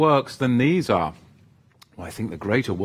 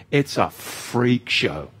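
slow, drawn-out scorn; slow freak show; slower than usual. Acted. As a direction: drag out every word in disdain. Mocking the online crowd.

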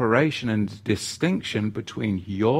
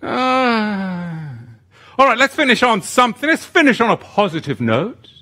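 higher, louder and fast, snapping out of it; sudden brightening; 9 semitones higher, 7 dB louder than usual. Real, not acted. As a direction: jump energetically to a new topic. Bursts back after a laugh.

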